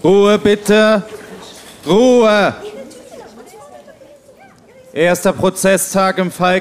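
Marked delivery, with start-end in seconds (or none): 0.1-2.5 calling for order. Acted. Judge calls for order.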